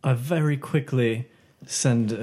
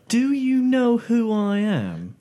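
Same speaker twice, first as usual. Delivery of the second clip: indignant; 9 semitones higher, 3 dB louder than usual. Acted. Player demands entry from bouncer.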